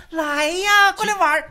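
high, loud, laughing come-hither call; mock ghost luring. Host mimics the dead husband beckoning, high and laughing. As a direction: tease like a ghost luring someone over. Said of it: "你怎么还能说".

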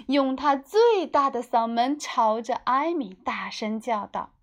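ordinary delivery

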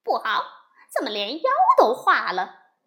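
loud, fast panicked shout; loud frantic yelp; alarmed cry. A narrator's reading. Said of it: "又叫道".

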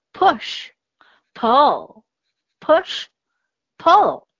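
whispered, slow 'push', 'pull' with a short u. Shown as a demonstration. Teacher models short U for pupils in a slow whisper. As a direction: breathe 'push' and 'pull' slowly, short vowel. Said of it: "字母U发作短音".

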